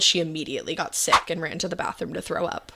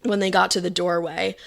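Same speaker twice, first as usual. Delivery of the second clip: normal talking voice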